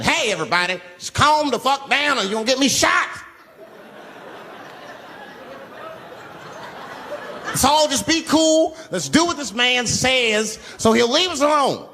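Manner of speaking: trying to calm passengers so he won't get shot; forced calm plea. Acted. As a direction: talk them down while scared. Calming the panicking bus.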